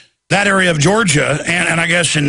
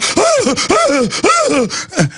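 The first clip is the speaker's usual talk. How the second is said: high burst of laughter; 12 semitones higher than usual. Real, not acted. Laughing at cops jumping out.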